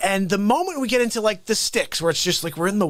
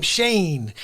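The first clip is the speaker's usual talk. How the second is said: ordinary delivery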